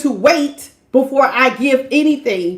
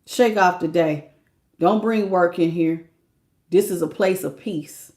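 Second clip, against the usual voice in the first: soft, lower, soothing partner voice; gentle lowered voice, softer; tender partner; 5 semitones lower, 4 dB quieter than usual. Retold or imitated. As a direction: soothe them home after work. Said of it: "gonna remind me".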